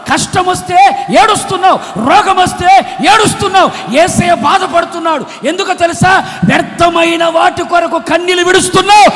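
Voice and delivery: loud, rising sermon cadence, pleading with the crowd; full-force preaching. Acted. Preacher urging the church to pray with tears, fervent and loud. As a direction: drive each phrase home like a revival preacher.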